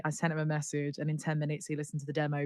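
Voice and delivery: ordinary delivery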